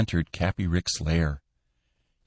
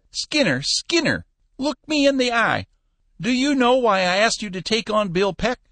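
put-on high, louder old man pleading and scolding; scolding old Cappy; 4 dB louder than usual. Old boss scolding his manager, high and loud. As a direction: rib him like a cranky old boss.

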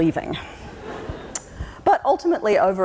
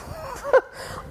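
high laugh; 5 dB quieter than usual. Real, not acted. Laughs at her new job.

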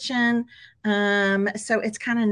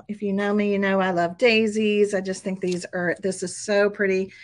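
usual speaking voice

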